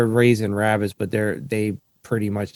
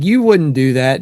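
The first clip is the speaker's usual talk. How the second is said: louder teasing protest; mock disbelief; 2 semitones higher, 6 dB louder than usual. Telling dad, loudly, he wouldn't do it. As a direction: call his bluff loudly. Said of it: "I was like".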